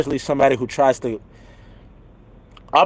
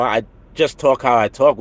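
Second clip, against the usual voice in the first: usual speaking voice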